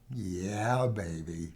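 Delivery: put-on deep, soft, slow yeah baby; low cool reply. To the excited client, a low knowing yeah baby. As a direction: purr it back knowingly. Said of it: "And I said".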